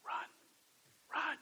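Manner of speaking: whispered, urgent warning; whispered urgency. Acted. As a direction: hiss it like a warning to him. Urgent aside to Joseph.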